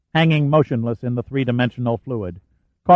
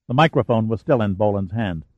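ordinary delivery, nothing marked

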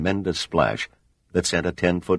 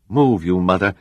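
loud urgent yell; 3 semitones higher, 6 dB louder than usual. A narrator's reading. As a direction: yell it at the rising ship. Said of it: "Giordino yelled".

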